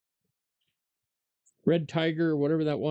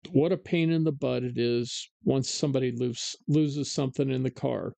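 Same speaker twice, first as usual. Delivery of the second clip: ordinary talk, nothing marked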